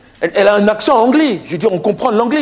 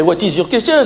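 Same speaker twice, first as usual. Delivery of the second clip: ordinary talk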